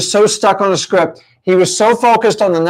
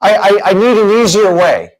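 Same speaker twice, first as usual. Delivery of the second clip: higher, louder pleading whine; student begging the coach for an easier way, higher and louder; mock whiny student; 5 semitones higher, 4 dB louder than usual. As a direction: whine for a shortcut like a desperate newbie. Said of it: "But some of you go".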